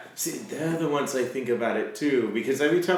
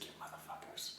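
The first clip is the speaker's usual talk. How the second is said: breathless whispered swear; mock whispered curse at the developers; 24 dB quieter, faster than usual. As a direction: hiss it under your breath at the game. Retold reaction to game difficulty.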